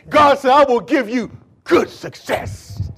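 high, loud proclamation, stretched out; full-force preaching. Acted. Preacher quoting God's promise to the congregation, full-force preaching.

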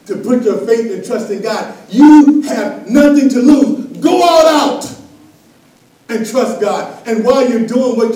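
loud, high, slow push on "You have nothing to lose"; preaching shout. Acted. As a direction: shout it to the congregation. Preaching at full force.

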